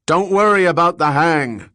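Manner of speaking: high, loud dismissive shout. Acted. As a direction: shout it down without looking up. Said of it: "Chad Gordon shouted".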